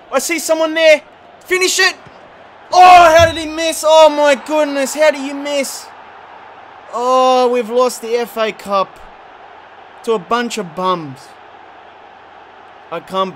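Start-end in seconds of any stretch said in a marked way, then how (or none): 0.1-11.2 high, loud frustrated yelling at the screen; real scream. Real, not acted. Gamer screaming as his team misses and loses the cup, real scream. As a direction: scream at the missed chance, then wail at the loss. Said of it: "I screamed and your headphones are gone".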